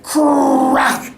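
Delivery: sharp mock crack noise; loud crack sound effect; imitated crack. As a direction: snap it out like breaking wood. Sound of boards breaking.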